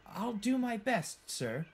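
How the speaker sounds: high, slow, brave attempt; putting on bravery. A narrator's reading. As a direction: sound willing despite the nerves. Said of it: "put on a brave face and said".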